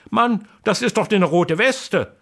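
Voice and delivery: high, excited exclaim; wife cries out on seeing the vest; astonished cry. Acted. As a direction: burst out in disbelief. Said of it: "ruft seine Frau und hält sich feste".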